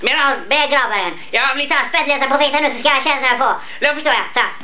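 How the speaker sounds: high-pitched cartoon bear on helium; Baloo. Bear coaching Mowgli to scare him, high-pitched cartoon impression. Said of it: "härma björnen Baloo när han träffar Mowgli".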